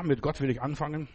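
usual speaking voice

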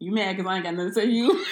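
fast, laughing teasing shout. Real, not acted. Host teasing co-host about her bad advice, laughing shout. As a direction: tease her through a laugh.

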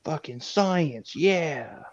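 high, drawn-out catchphrase; Jesse impression. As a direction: stretch it out like a character's catchphrase. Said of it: "Or whatever he says".

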